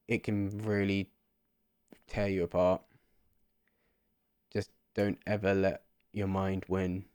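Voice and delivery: ordinary delivery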